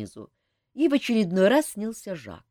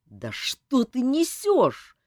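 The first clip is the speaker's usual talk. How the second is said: slow, raised furious question; enraged; 6 semitones higher, much slower than usual. A narrator's reading. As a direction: snap at her in rage. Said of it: "рассвирепел Элмар".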